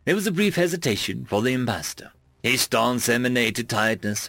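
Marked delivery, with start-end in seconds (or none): none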